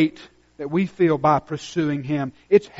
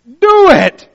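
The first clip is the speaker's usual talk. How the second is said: high, loud preacher's cry; preaching shout; 12 semitones higher, 14 dB louder than usual. Real, not acted. Pastor commanding the congregation to act, shouting. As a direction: hammer the command home.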